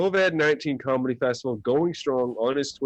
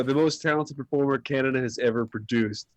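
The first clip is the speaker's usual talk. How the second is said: normal talking voice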